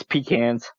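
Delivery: PECANS said low as "PEE-cans"; chosen pecans pronunciation. Shown as a demonstration. Answering the dialect quiz, pecans said low as "PEE-cans". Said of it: "Pecans or pecans?".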